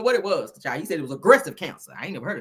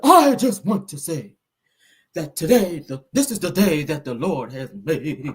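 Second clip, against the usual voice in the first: preacher quaver; 3 semitones lower than usual. Retold or imitated. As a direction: imitate a preacher with a long trembling final vowel. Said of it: "He was like that".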